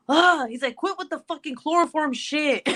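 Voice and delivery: imitating a fed-up husband; exasperated groan.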